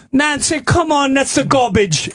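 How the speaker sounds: high, exasperated Jamaican caregiver; hammed-up Jamaican scolding; mock Jamaican accent. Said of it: "You hear the Jamaican woman going".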